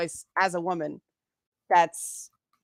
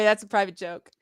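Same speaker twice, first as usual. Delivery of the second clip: normal talking voice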